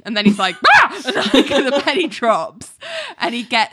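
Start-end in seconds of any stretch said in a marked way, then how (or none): 0.7-1.0 short, loud, high-pitched yelp; mock startled scream. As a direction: yelp as if caught out. Acting out his startled realisation.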